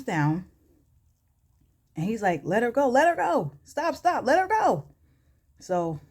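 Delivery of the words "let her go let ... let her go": climbing, high-pitched urgent order; urgent command. Retold or imitated. Kendrick orders security to release her, urgently. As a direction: call them off, rising as it repeats.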